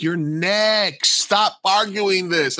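retold fed-up protest, high and stretched; exasperated order. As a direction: spell it out for a stubborn arguer. Acted exasperated order to arguing customer.

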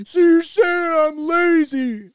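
put-on high, slow whine of a kid melting down; mock a wailing kid, loud and drawn out; son melting down. Son protesting to his dad after a lecture, high melodramatic wail. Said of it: "my boy just melts down".

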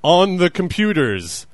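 slow, high, loud grand wartime speech; mock Churchill oratory. Parodying Churchill's beaches speech with slow, loud pomp. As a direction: orate it like a mock wartime leader. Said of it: "quote from, uh, Winston Churchill".

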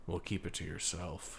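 flat, soft, low deadpan delivery; dead serious, no emotion. Pretend. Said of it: "Just in a very deadpan voice".

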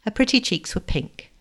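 usual speaking voice

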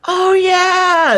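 very high, drawn-out fake recognition; mock gushing. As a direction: squeal in pretend familiarity. Mimics people pretending to know.

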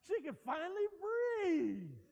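loud, slow, stretched out; joyful shout. Real, not acted. Pastor celebrating the healing before the church, loud and slow. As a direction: belt the punchline with joy.

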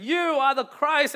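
high, loud, slow cry; exuberant exclamation. Retold or imitated. Peter exclaiming to Jesus in front of the disciples, exuberant. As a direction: exclaim it with joy. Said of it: "this exuberant, uh, exclamation".

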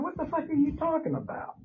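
high-pitched mock outrage; retold indignant retort. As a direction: re-enact an incredulous protest in a high voice. Retelling his retort to nurses.

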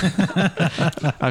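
slow, stretched-out complaint; drawn out. Real, not acted. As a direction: drag the words out sadly. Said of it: "How does this feel?".

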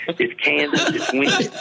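talking through real laughter; laughing. Real, not acted. Hunter cracks up recalling the windy tree stand, laughing. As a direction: crack up while telling it.

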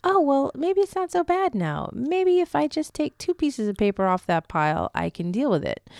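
a lightly voiced sense of relief; hopeful inner voice, lightly done; relieved inner voice. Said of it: "you may feel".

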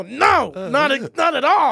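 high, loud, slow exasperated denial; exasperated teacher. Retold or imitated. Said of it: "You go".

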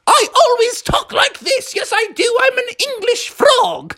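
very high, hammed-up English accent; mock English accent. Froggy claiming to be English, high mock accent. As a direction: insist in a squealed English accent. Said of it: "talk in an English accent also".

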